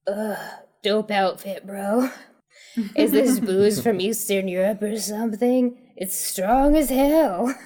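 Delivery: slow rasping stoner drawl; raspy voice. Acted. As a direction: rasp it like a wasted partygoer. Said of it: "A shredded voice rasps".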